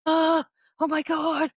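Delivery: slow, high, drawn-out oh my God; mock wide-eyed student wonder; amazed gasp. Imitating students awestruck on reaching mediumship, slow and high.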